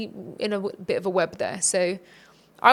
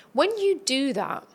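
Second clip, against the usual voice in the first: ordinary talk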